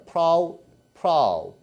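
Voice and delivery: 프라우 with an "ow" glide, rhyming with "now"; first syllable 프라우. Shown as a demonstration. Teacher isolating the first part for students, 프라우 said as "prow". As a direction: say 프라우 alone as one drawn "prow". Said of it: "프라우까지만 쓰면 돼요".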